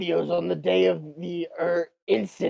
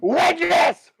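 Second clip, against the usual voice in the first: loud call; 12 semitones higher, 6 dB louder than usual. Acted. Lawyer calling out in court.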